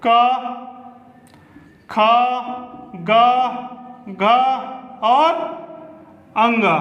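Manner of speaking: teacher voicing the throat letters; demonstrating sounds. Shown as a demonstration. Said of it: "जैसे".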